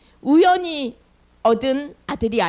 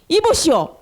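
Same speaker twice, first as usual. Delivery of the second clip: acted high, raised-voice telling-off; stern scolding; 7 semitones higher, 4 dB louder than usual. Priest scolding Hannah for seeming drunk, loud stern rebuke. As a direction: scold hard as an indignant priest. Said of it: "하고 막 혼냈어요".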